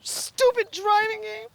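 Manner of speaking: shrill, high-pitched angry outburst; yelling at the stupid game in frustration; angry tantrum. Real, not acted. Tantrum over failed driving test.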